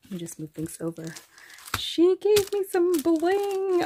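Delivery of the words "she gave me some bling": higher, louder burst of delight; excited. Real, not acted. Telling viewers about her friend's bling, higher excited burst. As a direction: announce the bling with a thrill.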